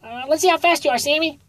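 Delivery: high, quick rabbit coach voice; high coach sizing up a new runner. A narrator's reading.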